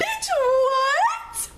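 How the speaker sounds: high, stretched-out shocked squeal; high loud shocked "bitch, what"; shocked outburst. Retold or imitated. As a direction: squeal it in disbelief. Retelling shock at his confession.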